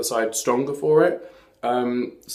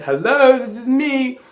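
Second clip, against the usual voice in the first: sassy; 11 semitones higher, 7 dB louder, slower than usual. Real, not acted. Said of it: "sassy self-presentation".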